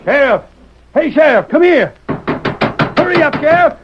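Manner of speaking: loud urgent calls, pitched high; calling out. Acted. Outlaw in a cell shouting for the sheriff. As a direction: yell for the sheriff to come quick.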